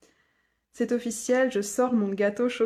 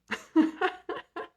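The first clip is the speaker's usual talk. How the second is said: high laugh; 10 semitones higher than usual. Real, not acted. Laughs at her own question.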